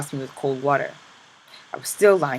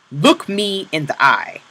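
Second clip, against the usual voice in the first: slow, louder order; slow stern command; 4 semitones higher, 6 dB louder, slower than usual. Acted. Capo ordering the prisoner to look at him, slow and firm. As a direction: make him meet your eyes.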